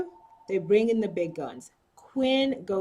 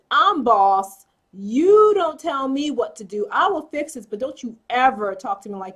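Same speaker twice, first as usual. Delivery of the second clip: asserting dominance; 3 semitones higher, 7 dB louder than usual. Retold or imitated. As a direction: lay down who is boss. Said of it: "he's telling Edison".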